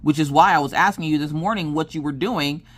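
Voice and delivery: ordinary delivery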